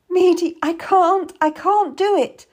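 high, loud, anguished protest; panicked wail of a woman in labour; desperate cry. A narrator's reading. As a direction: cry out that you cannot go on. Mother cries out in labour agony.

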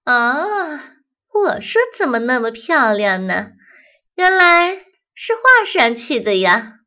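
loud, high mocking "原来是画上去的呀" with rising tune; a bee feigning shock, sneering; mock surprise. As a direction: fake astonishment at 画, voice climbing.

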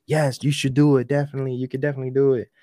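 high, eager agreeing voice; yes man. Retold or imitated. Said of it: "yes men are just gonna be like".

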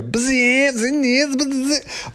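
high, quick mock motor buzz; high imitated bzz of moving chess pieces. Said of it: "all the chess pieces go".

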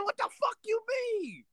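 high falsetto retold disbelief; comic high-pitched outrage; incredulous. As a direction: squeal the question in mock shock. Retelling his shocked reply.

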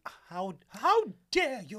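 high, loud, slow offence; mock outrage; offended outrage. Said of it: "make her think".

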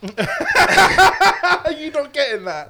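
slow laughing question. Real, not acted. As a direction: laugh through the words. After the shot.

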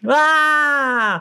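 high-pitched startled yelp; sudden yelp. Acted. Throwing phone demo bit.

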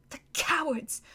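high, soft sobbing words; tearful whisper. Acted. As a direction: choke it out through tears. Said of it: "sobbed Susan".

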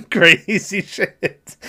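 slow words broken up by laughter; high, drawn-out laughing delivery. Real, not acted. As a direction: say it through a real laugh. Laughs while recalling own reaction.

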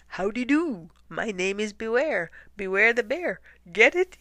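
high, loud, goofy bear introduction; perky bear delivering a punchline; jokey bear. A narrator's reading. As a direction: announce it like a corny joker. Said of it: "a bear thudded up to the penguins and announced".

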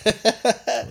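high, loud sudden "oh"; surprised exclamation. Real, not acted. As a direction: burst out in surprise. Reacting to the video.

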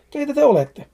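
scared man pleading with fairies; frightened question. Acted. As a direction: ask in panic who they are.

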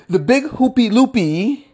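silly sing-song; playful. Acted. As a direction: toss it up playfully. Playful silly phrase repeated.

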